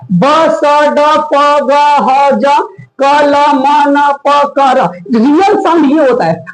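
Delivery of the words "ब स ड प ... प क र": each sound from ब to र evenly spaced, held on its vowel; phonic letter sounds. Shown as a demonstration. Teacher shows learners the real sounds, phonic recitation. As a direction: chant ब, स, ड one by one with open "a" endings. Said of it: "reality sound यही आपका होता है".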